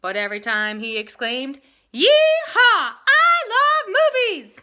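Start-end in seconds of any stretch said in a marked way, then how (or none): 1.9-4.6 very high, very loud cowboy whoop; too loud. A narrator's reading. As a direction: holler it with delight. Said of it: "his words came out too loud".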